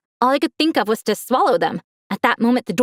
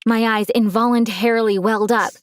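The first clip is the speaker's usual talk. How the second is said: ordinary delivery, nothing marked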